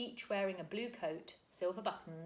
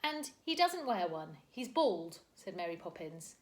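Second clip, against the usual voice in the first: ordinary delivery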